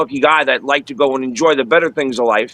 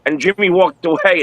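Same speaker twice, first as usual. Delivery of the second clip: normal talking voice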